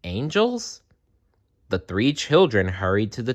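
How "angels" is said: high rising surprised question; puzzled echo. Acted. Children echoing the soldier, surprised rising. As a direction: echo it in disbelief.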